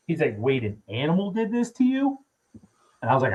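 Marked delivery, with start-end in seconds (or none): 0.4-2.2 high-pitched incredulous question; retold disbelief. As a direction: imitate a stunned doctor double-checking. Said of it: "he could not believe".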